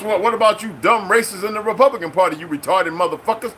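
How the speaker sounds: usual speaking voice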